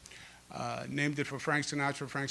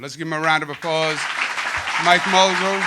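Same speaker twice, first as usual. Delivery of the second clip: rally the crowd, louder on the name; raised-voice cue for applause; calling for applause; 10 dB louder than usual. Real, not acted. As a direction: build to a loud shout of the name.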